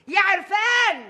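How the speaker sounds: loud, high call across the house; mother summoning her husband, shouting his name. Acted. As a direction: yell for Erfan to come.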